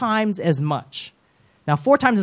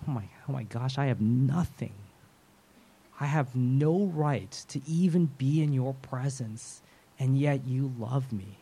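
humbled prayer; 4 semitones lower, 9 dB quieter than usual. Acted. Sinner's humbled prayer to God.

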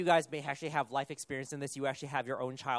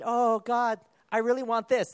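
pleading; 6 semitones higher, 9 dB louder than usual. Acted. Believer begging God for something.

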